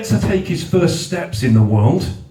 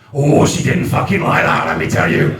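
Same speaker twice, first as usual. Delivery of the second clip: ordinary talk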